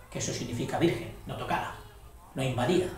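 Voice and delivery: murmured explanation, unvoiced; whispered aside. Real, not acted. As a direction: whisper it to the student. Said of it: "drops to a whisper explaining".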